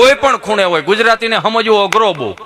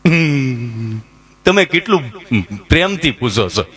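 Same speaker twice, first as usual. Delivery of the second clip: low, dragged-out stammering answer; a stuttering simpleton slowly gets his name out; slow dim stammer; 8 semitones lower, slower than usual. Retold or imitated. To the nurse, Gabho answers his name in a low stammer.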